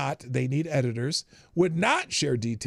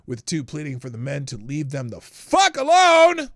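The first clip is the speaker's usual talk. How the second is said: louder, punched-up dramatic read; enhanced loud reading; 8 dB louder than usual. Acted. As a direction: hammer the line out like you're outraged. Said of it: "I enhanced that a little bit".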